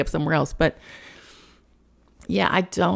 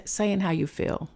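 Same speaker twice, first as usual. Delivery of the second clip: ordinary talk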